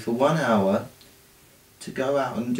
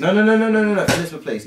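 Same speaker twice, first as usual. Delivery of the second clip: rapid high pleading nos, loud; loud panicked no, no, no; 9 semitones higher, 5 dB louder, much faster than usual. Real, not acted. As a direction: beg her to stop, loud and high. Begging sister not to show.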